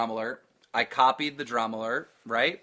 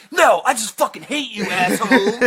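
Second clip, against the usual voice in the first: high, louder mock-angry outburst; hateful commenter; 8 semitones higher, 7 dB louder than usual. Host quotes a hostile commenter, mock-angry and loud. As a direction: imitate an irate commenter, pitched way up.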